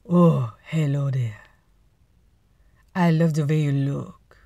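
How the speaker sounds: hammed-up French charmer; typical French accent. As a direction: flirt in a cartoon French accent. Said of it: "That's your typical French accent".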